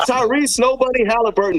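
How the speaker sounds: hype shout-out. Pretend. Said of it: "You know what we got to do".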